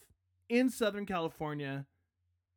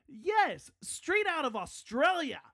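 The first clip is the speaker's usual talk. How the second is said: a bad, over-high Australian accent; hammed-up Aussie voice, badly faked; mock Australian accent; 7 semitones higher than usual. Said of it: "I'm not really good at accents".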